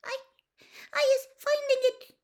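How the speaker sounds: very high, halting, squeaky voice; frightened elf. A narrator's reading. House-elf answering Mister Weasley, frightened and squeaky. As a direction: squeak it out timidly in fragments. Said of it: "she whispered".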